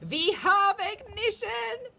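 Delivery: high, loud, slow gleeful witch; triumphant witch. A narrator's reading. Witch crowing as the spell starts. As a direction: crow it in triumph.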